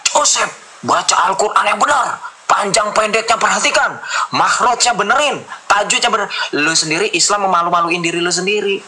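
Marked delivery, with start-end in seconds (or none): 0.1-6.3 higher, slow mock scolding of a fellow believer; lecturing friends. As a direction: imitate friends nagging him to recite properly. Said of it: "diperingatkan oleh teman-teman seimanmu".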